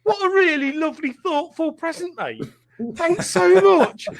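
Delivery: laughing mock-sincere thank you; fake gratitude. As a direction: play along with forced delight. Fake gratitude in retold story.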